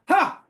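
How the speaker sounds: high, loud one-syllable brush-off laugh; imitating Mother waving him away with a high, loud "Ha!"; dismissive ha. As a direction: toss off a sharp, high "Ha!". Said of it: "She went".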